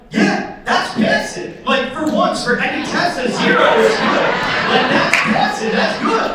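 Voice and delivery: high, loud, triumphant insistence; excited protest. Acted. Driver telling officer that zero is passing, excited. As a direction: argue your win with mounting, squealing excitement.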